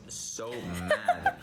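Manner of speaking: laughing through mad; breaking into a laugh. Real, not acted. Laughs mid sentence.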